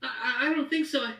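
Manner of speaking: put-on high, fast, tearful little boy; scared child. The halfling boy answering Bertha, high and tearful. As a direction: stammer it out through tears.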